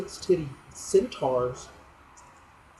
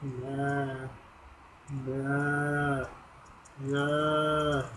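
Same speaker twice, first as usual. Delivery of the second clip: low monotone crying; 5 dB quieter, much slower than usual. Retold or imitated. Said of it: "a low, monotone voice just crying".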